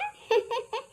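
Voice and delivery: light high laugh; aunt laughing off the boy's worry; high giggle. Acted. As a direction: laugh it away.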